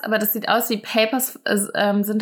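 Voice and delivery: usual speaking voice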